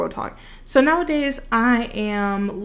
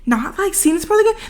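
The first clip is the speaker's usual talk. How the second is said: surprised self; 9 semitones higher than usual. Retold or imitated. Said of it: "I'm like".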